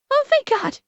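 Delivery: high relieved thank God. Real, not acted. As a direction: squeal it with relief. Relief that alerts work.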